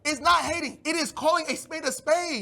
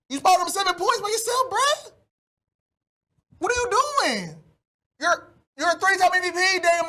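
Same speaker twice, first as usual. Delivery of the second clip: exasperated yell; 6 semitones higher than usual. Real, not acted. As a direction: yell in disbelief, voice jumping high on bruh. Exasperated rant at quarterback.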